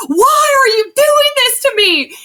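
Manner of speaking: high, drawn-out wailing yell. Retold or imitated. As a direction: yell it in exasperated, over-the-top protest. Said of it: "I'm yelling at Tyler Allgeier".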